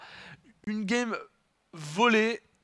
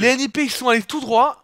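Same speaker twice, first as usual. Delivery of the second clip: excited; 6 semitones higher, 8 dB louder than usual. Real, not acted. Caster recounts fast push.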